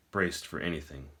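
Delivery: usual speaking voice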